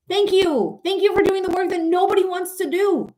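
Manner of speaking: high, insistent thanks; emphatic gratitude. Real, not acted. Streamer thanking immigrant workers, high emphatic gratitude. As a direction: thank them with exasperated force.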